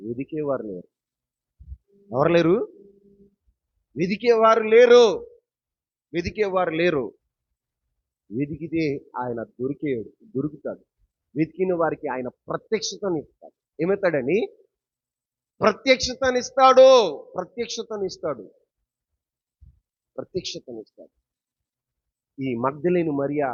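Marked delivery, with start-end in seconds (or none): 4.0-5.2 preaching shout. Real, not acted. Repeated phrase, loud take. 15.6-21.1 fading repetition. Real, not acted. Preacher repeating the promise to the church, fading from a shout to a hush.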